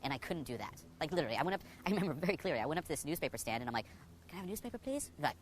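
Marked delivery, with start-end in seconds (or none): 4.3-5.1 higher, softer, unsure voice; timid ask. Retold or imitated. Nervous young man asking the newsstand lady for a newspaper. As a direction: squeak it out, barely daring.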